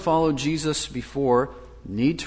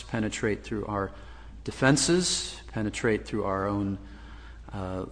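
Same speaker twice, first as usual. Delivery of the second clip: hushed, lower-pitched congregational prayer; 3 semitones lower, 4 dB quieter than usual. Real, not acted. Preacher asks God that Christ's words reach hearts, subdued prayer. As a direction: pray earnestly, softening toward the amen.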